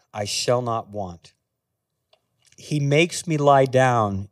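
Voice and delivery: slow, low scripture reading with long pauses. Real, not acted. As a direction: read the psalm slowly, leaving space after each verse. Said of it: "read it again slowly".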